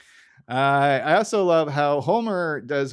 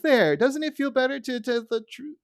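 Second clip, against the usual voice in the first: high prim schoolteacher voice; mock smug teacher; lightly voiced prim teacher; Edna voice; 11 semitones higher than usual. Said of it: "Edna kinda looks over and is like".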